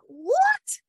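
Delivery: sudden very high squeak of disbelief; amazed surprise. Retold or imitated. Recalling hearing the villagers laugh, shocked high squeak. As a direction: jump way up in astonishment. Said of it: "And I am just like".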